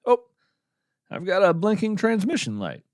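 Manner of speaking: sudden high "oh", a pleased discovery; surprised. Real, not acted. Host spots the radio's blinking light, surprised. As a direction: catch sight of it mid-thought.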